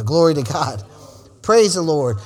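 ordinary talk, nothing marked

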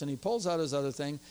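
normal talking voice

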